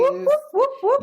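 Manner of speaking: high, far-off whoop whoop; excited whooping. Real, not acted. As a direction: cheer it like a party. Cheering at West End Live.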